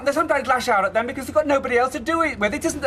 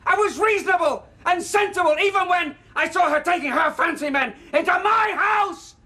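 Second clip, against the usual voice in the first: bitter angry rant; 5 semitones higher than usual. Acted. Father raging at his daughter about her mother, building to shouting. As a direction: pour out years of humiliation, rising to a roar.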